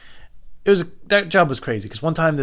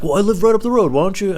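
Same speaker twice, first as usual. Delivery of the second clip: high, loud pushy imitation; put-on stranger's voice; 10 semitones higher, 7 dB louder than usual. Stranger urging the driver to visit, high loud mimicking voice. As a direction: mimic him pressing, pitched up.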